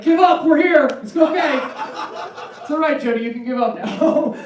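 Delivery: a pack of cats comforting a single woman; cats consoling. Retold or imitated.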